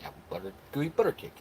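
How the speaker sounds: rushed, breathy tongue twister; tongue twister said fast. Shown as a demonstration. Reading a viewer's tongue twister, fast and breathy. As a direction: rattle it off quick as a tongue twister. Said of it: "I said it three times fast".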